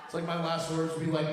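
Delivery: normal talking voice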